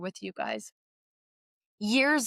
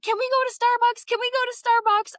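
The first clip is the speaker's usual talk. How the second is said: put-on high pleading kids' voice, repeated; begging kids; 13 semitones higher, 5 dB louder, faster than usual. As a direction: beg like eager daughters.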